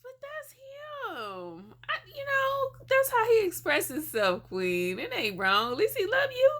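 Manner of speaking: high, put-on voice teasing the couple; high mock voice. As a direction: squeak it out as a sassy friend. Friend voices high mock defense.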